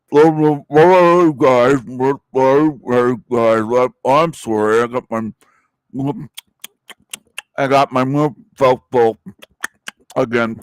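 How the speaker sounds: lightly voiced YouTuber mumbling through a mouthful; mock a YouTuber talking with his mouth full; mock Tarek with mouth full. Ranting vlogger mocks rival Tarek, talking through a full mouth. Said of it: "Come on my channel like Tarek ... with your mouth full".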